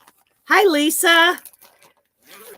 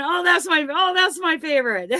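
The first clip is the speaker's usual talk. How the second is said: delighted gush; 6 semitones higher than usual. Retold or imitated. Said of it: "Then I look at the next one".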